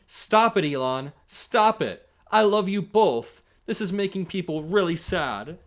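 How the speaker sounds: high, loud, begging fan voice; hammed-up plea to Elon; mock pleading fan. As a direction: whine it like a desperate fan. Reading a pleading fan post.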